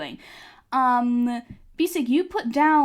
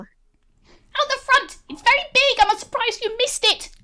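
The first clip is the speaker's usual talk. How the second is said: put-on much higher, small girl's voice; little May; 12 semitones higher, 4 dB louder than usual. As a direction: speak as a tiny proud girl. GM voicing May.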